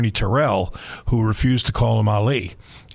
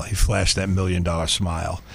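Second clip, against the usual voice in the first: ordinary delivery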